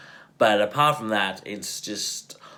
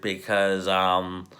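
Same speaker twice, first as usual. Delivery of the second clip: ordinary talk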